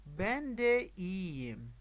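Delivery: slow, drawn-out model phrase; repeat-after-me model. Shown as a demonstration. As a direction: stretch it slowly for learners to copy. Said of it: "پشت سر من تکرار کنید".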